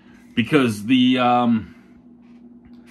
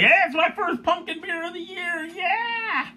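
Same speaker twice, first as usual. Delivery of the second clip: much higher, squealing giddy cheer; a hammed-up squeal of delight at the first pumpkin beer; excited squeal. Retelling his excitement over the first pumpkin beer, hammed-up squeal. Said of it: "I was like".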